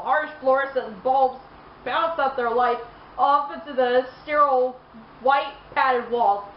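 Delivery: ordinary talk, nothing marked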